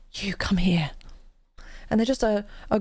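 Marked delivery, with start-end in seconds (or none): 0.1-0.9 hushed unvoiced beckon; whispering. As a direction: whisper it like a secret summons. Retold stranger beckoning secretively.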